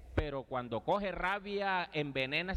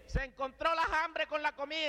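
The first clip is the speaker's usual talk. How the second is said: war cry; 9 semitones higher, slower than usual. Retold or imitated. Father greeting his enemy at the fiesta, high challenging yell. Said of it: "El grito fue".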